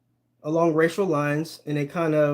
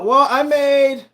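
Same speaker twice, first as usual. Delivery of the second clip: normal talking voice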